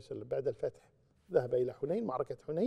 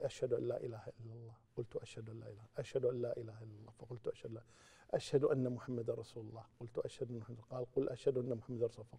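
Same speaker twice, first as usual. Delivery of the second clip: low, soft recitation of the shahada; lowered voice; 3 semitones lower, 10 dB quieter, faster than usual. Retold or imitated. Companion repeating the call to prayer after the Prophet, lowered voice. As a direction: murmur the testimony, pitched a little under normal. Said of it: "قلتها بصوت منخفض".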